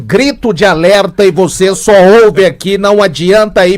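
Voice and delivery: loud caller over a phone speaker; shouted announcement through speakerphone. Real, not acted. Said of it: "Põe no Viva Voz aí".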